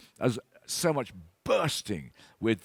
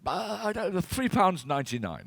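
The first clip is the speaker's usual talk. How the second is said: flustered hesitation; 8 semitones higher than usual. Retold or imitated. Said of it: "And I went".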